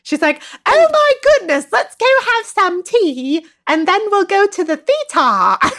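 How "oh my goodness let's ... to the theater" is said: high, hammed-up mock British accent; childishly overdone British accent, pitched way up; bad British accent. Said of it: "fourth grader's impersonation of a British person".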